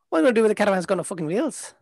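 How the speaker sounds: fast, mumbled Irish traveller Pikey accent; Pikey accent from Snatch. Shown as a demonstration. Voice actor shows off Snatch accent, very fast Pikey mumble. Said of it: "that Pikey accent in, in Snatch".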